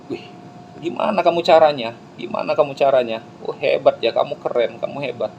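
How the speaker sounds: high, impressed praise; kagum dari yang kalah. Acted. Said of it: "yang kalah-kalah tadi". (kagum bersemangat, nada tinggi)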